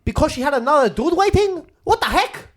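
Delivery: a hint of a mock Chinese accent; lightly put-on Chinese accent, loud. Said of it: "do it in a Chinese accent".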